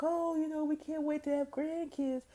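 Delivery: high sing-song voice of eager parents. Retold or imitated.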